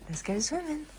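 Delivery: high sing-song coaxing. Real, not acted. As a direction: coax the dog in playfully. Coaxing dog into the sea.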